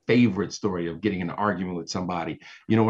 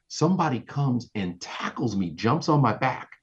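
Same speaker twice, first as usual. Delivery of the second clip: ordinary talk, nothing marked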